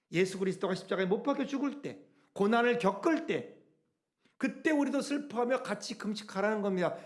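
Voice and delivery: usual speaking voice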